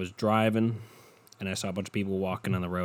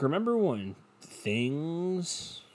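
slow, higher, words stretched out; 7 semitones higher, slower than usual. Real, not acted. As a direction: drag out the key words with swooping pitch. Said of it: "It sounds so slow".

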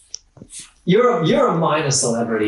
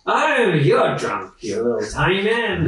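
put-on slow, swooping drunken retort; indignant drunk driver; 4 semitones higher than usual. Coach driver retorting to a passenger, tipsily indignant. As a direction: throw the accusation back, slurred and drawn out.

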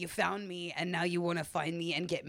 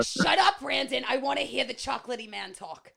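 loud, high comic scolding; mock angry outburst; 7 semitones higher, 8 dB louder than usual. [name] silencing the host to hear the guest, loudly scolding. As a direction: cut him off with a hammed-up yell.

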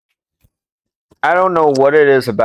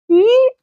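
high, thin squeak; imitated chair creak; 4 dB louder than usual. Storyteller mimics the chair creaking under him, high squeak. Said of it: "not supposed to make that noise".

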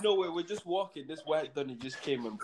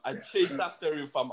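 ordinary talk, nothing marked

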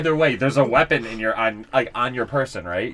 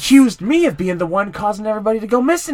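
louder, higher indignant undertaker; annoyed accusation; 9 semitones higher, 10 dB louder than usual. Acted. Undertaker accusing the traveler of being a street tough, indignant. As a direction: accuse them of being ruffians.

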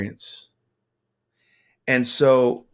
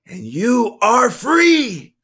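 high, loud, drawn-out cry; exultant, full-voiced declaration; triumphant proclamation; 12 semitones higher, 8 dB louder, slower than usual. A narrator's reading. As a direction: shout freedom as the climax. Climax of the proclamation.

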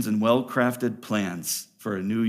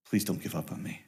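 much softer, low, pleading prayer; quiet begging; 5 semitones lower, 8 dB quieter, faster than usual. Retold or imitated. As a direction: plead softly like a man begging God. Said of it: "He is begging".

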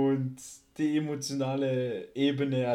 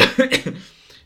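choked cough; 12 semitones higher, 8 dB louder than usual. Retold or imitated. Acting choked up on phone.

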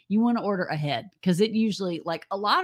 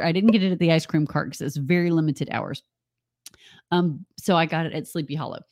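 ordinary delivery

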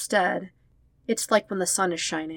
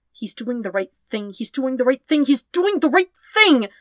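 from muttered to high, loud cry; mantra building to a shout; 7 semitones higher, 6 dB louder than usual. Acted. Traitor repeating his mantra over his father, rising to a shout. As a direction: build the mantra until it breaks into a yell.

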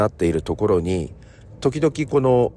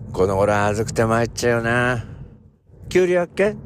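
raised, folksy Ibaraki country dialect; Ibaraki dialect skit; 2 semitones higher than usual. Acted. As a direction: play an old Ibaraki farmer chatting with a neighbour. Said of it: "こういうやつなんですよ".

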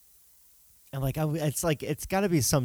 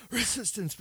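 Resistance coughed out quietly, higher; fake cough; 10 semitones higher, 7 dB quieter than usual. Shown as a demonstration. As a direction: hide the word Resistance in a pretend cough. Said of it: "like, pretending to cough".

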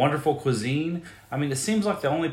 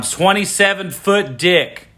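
"Twenty-seven foot dick" said slower and louder, each word hit; loud repeat; 5 semitones higher, 9 dB louder, slower than usual. Shown as a demonstration. Host repeating for the microphone, loud and slow. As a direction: belt it into the microphone. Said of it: "I'm gonna say this one more time".

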